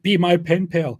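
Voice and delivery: high, loud mock proclamation; mock yell. Reading joke of Gabriel in the cave, mock yell. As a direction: call it out like a hammed-up angel. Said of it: "yelled at Muhammad".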